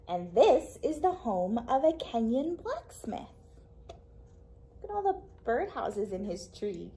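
lively, expressive storytime read-aloud; reading to children. Acted. Librarian reading a picture book to kids, lively storytime voice.

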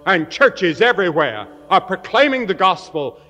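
loud, ringing revival preaching; full-force preaching. Acted. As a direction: proclaim it to the whole hall. Revival preaching over music.

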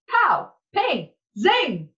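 high, loud pow, ping, zing; loud high comic-book bursts; cartoon sound effects. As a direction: bang them out like comic noises.

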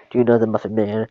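usual speaking voice

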